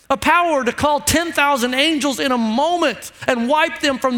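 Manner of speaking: fiery pulpit build; full preaching. Acted. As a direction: preach it out with rising fervour.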